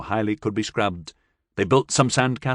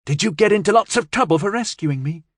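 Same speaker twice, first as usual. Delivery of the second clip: anxious; 9 semitones higher, faster than usual. Acted. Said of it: "Jay asked anxiously".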